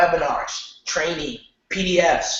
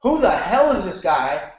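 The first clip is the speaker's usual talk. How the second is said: exasperated email reader; 5 semitones higher, much slower than usual. Retold or imitated. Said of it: "someone's gonna say".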